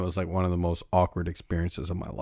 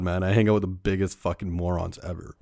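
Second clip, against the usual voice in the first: usual speaking voice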